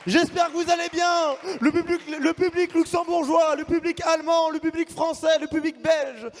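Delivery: high, lively host voice greeting the crowd; esports caster. Acted. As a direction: work the crowd like an MC.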